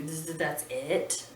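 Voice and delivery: usual speaking voice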